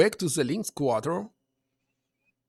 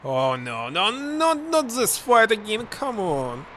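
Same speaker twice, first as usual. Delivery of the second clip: high, louder pleading no no; exasperated groan; 5 semitones higher, 5 dB louder, slower than usual. Real, not acted. Player facing the same fight again, exasperated. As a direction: whine in dread.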